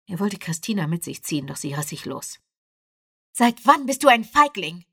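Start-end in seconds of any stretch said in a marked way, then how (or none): 3.3-4.9 loud, high, angry blurt. A narrator's reading. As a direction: spit it out at him. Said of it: "stieß sie hervor".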